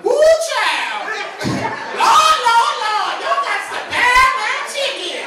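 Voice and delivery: very high, loud, whooping ad lady; Popeyes lady impression. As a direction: holler it like an over-the-top chicken ad spokeswoman. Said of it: "she talks like a runaway slave".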